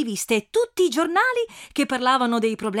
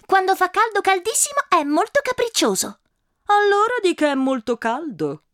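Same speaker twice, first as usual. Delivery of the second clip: put-on little girl; 10 semitones higher than usual. Little girl answers the adult back, high child voice. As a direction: play a stubborn small girl. Girl's voice continues.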